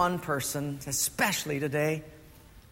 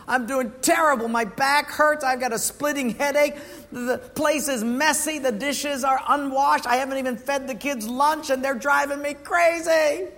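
higher, whiny complaint of a worn-out mom; a mildly raised, weary voice of a mother at her limit; frazzled mother; 7 semitones higher than usual. Retold or imitated. Exhausted young mother on phone.

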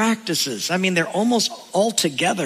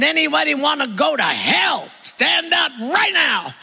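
loud, higher shout, slowing at the end; 7 semitones higher than usual. Retold or imitated. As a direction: boom it across the church to startle him. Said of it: "And then in a loud voice".

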